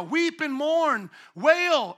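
slow, loud, high call to weep and mourn; loud command. Pretend. Preacher voicing God to a megachurch pastor, slow loud command. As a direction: cry it out like a prophet's demand.

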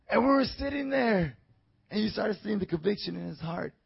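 usual speaking voice

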